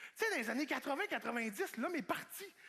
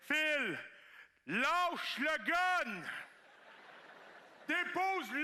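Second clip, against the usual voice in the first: friends yelling; 4 semitones higher, much slower than usual. Retold or imitated. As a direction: yell at him to drop it. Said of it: "ils me disaient". (cri lent et fort)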